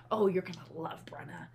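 hushed, secretive whisper. Retold or imitated. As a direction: breathe it like a secret. Retold friend's hushed remark.

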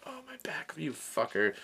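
pained whisper; muttered whisper in pain; whispered curse. Retold or imitated. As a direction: hiss the curse so nobody hears. Hurt, cursing friend privately.

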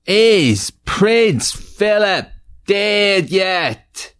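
high, slow, shouted title. Acted. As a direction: belt out the show's title.

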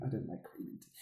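quiet mutter under the breath; muttered aside. Real, not acted. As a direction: mumble it to yourself.

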